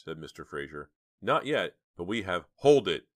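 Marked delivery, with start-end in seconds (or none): 1.2-2.5 firm. A narrator's reading. Detective pressing the family. 2.6-3.1 loud, high, cut him off hard; sharp interruption. A narrator's reading. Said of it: "said Mister Martinson, cutting him off".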